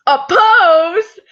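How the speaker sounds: high, loud comic announcement; dramatic reveal. Acted. Reader springs a silly twist on listeners, dramatic reveal.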